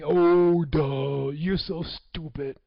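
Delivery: loud, slow schoolyard taunt, the oh shouted; mocking kids. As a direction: jeer it like a bully in the playground. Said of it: "Those kids would call me".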